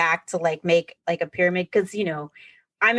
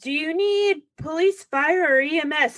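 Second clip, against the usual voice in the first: dispatcher voice; 8 semitones higher than usual. Retold or imitated. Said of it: "they were like".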